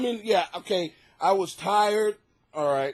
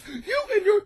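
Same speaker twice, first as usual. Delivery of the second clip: high, squeaky mock rant; pissed-off listener; 4 dB louder than usual. Listener sending in an emotional complaint, high mock anger. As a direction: act out an angry complainer in a high voice. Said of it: "if you are that pissed off".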